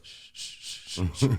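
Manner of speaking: soft unvoiced shushing of a class; mock class shushing. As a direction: hiss shh like kids quieting each other. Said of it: "everybody goes".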